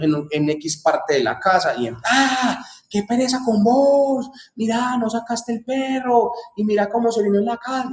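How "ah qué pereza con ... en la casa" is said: wife yelling at husband over the dog; exasperated wife yelling. Retold or imitated. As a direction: shriek at the husband about the dog mess. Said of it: "Y el mero grito".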